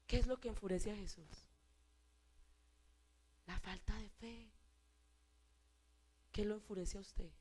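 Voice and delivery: soft, low, intimate preaching; quiet appeal. Real, not acted. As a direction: draw the church in close.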